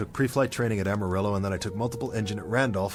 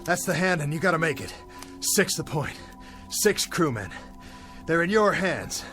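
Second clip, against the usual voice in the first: higher, emphatic dressing-down; stern self-reproach; 8 semitones higher than usual. Acted. Captain lecturing himself about his six crewmen, stern and urgent. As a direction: drive the responsibility home, pitched up.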